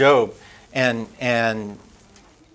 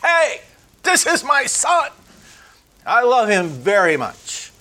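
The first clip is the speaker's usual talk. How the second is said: much higher, ringing proud call; the preacher voicing God's call from heaven, high and proud; God shouting from heaven; 10 semitones higher than usual. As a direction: boom it down from heaven, a father's joy. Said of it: "he shouted".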